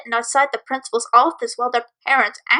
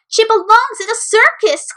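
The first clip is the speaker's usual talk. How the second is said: higher, jeering sneer; mocking; 9 semitones higher, 4 dB louder than usual. Bully jeering at the filly, a high mocking sneer. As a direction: laugh at her as a freak. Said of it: "Keyboard said with a mocking voice".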